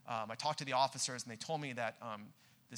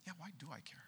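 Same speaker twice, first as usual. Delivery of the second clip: quiet inner thought; 15 dB quieter, much faster than usual. Retold or imitated.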